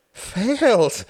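high, loud, falling wail; despairing wail. A narrator's reading. Hart echoing his friend's verdict, wailing in despair. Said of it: "wailed Hart in despair".

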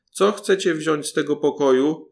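called out loud to the thieves. A narrator's reading. Paluszek calls loudly from the priest's room, loud call. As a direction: shout it across the room. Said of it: "zawołał, jak mógł, najgłośniej".

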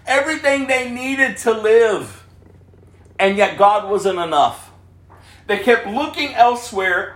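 high, loud, driving preacher voice; fiery sermon delivery; full-force preaching. Acted. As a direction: preach it out to the whole room.

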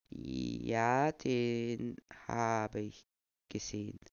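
put-on old man, slow and fading; deliberate old man. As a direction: weigh every word. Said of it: "erklärte Noah bedächtig".

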